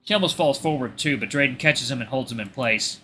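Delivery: usual speaking voice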